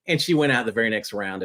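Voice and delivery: ordinary talk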